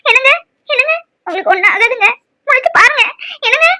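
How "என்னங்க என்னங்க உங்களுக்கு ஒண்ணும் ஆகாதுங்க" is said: ordinary talk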